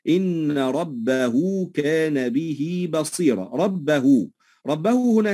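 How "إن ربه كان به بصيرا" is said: slow recitation, ربه as rabbahuu and به as bihii; connected ha lengthened. Shown as a demonstration. Teacher recites a Quran example, slow with lengthened vowels. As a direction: draw out the endings of ربه and به. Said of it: "يمد بمقدار حركتين".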